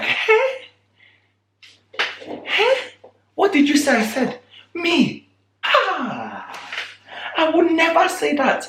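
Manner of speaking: high-pitched mock protest of innocence; protesting Farouk. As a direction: deny everything in a squeaky outraged voice. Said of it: "My man Farouk was like".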